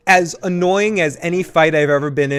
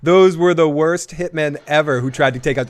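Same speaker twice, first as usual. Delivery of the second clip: ordinary talk, nothing marked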